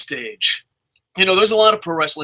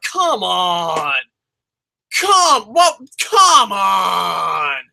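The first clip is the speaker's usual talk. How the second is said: exasperated shout; 2 semitones higher, much slower than usual. Real, not acted. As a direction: yell it in outrage. Ranting at the booking decision.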